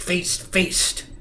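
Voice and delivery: ordinary delivery, nothing marked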